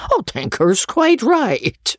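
slow, high coarse squire; an old baronet chuckling along; crude old baronet. Acted. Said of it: "said the baronet".

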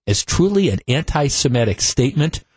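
ordinary talk, nothing marked

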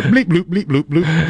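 high-pitched silly alien bleeps; mock alien chatter. Co-host joking that aliens built it, mock alien chatter. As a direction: play a little alien in a high voice.

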